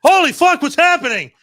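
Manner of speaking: hammed-up terror, voice shooting way up; panicked shriek. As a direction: play a startled girl waking mid-fall. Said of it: "she wakes up and like".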